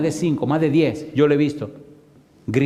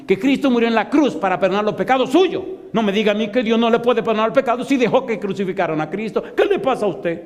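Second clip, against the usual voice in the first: preaching at full force; 8 semitones higher than usual. Acted. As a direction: hammer the killer with each question. Preacher confronts the hitman.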